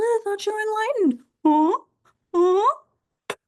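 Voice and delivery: high, mocking prodding; goading taunt. Pest provoking the enlightened one, taunting. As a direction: goad them with high, pushy questions.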